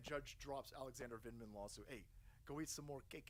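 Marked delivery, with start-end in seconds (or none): none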